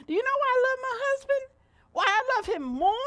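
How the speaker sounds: high, loud and fast teasing question; excited question. Real, not acted. Preacher teasing the church about her husband, rising excited. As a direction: lift it up to tease the crowd.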